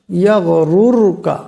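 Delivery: ইয়াগরুরকা slowly, both র heavy. Shown as a demonstration. Teacher slowly showing ইয়াগরুরকা with heavy র sounds. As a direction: drawl ইয়াগরুরকা with thick র sounds. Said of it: "মোটা আওয়াজে পড়তে হবে".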